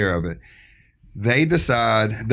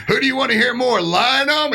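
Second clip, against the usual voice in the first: mock MC; 13 semitones higher than usual. Organizer polling the crowd on the mic, high announcer voice. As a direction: ask the crowd like a hype man. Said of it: "I hear him go".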